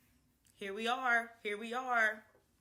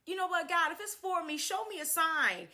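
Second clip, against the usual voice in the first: louder, higher plea to the heavens; prayer to God; 7 semitones higher, 6 dB louder than usual. Retold or imitated. Asking God for a sign.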